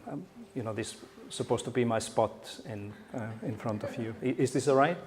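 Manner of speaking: ordinary talk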